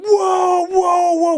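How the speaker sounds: high, loud panicked whoa whoa; panicked yelps. Retold or imitated. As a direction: yelp in shock like a man just hit. Sparring partner hit, panicking.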